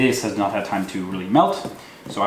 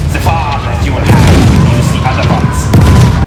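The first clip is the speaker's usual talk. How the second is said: shouting; 12 dB louder than usual. Pretend. Sudden outburst mid-sentence.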